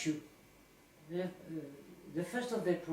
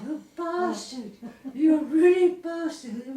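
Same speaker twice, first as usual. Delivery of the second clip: slow, much higher and louder, laughing through it; laughing mock insult; 8 semitones higher, 9 dB louder, slower than usual. Victim of the April Fool prank laughs out her insult.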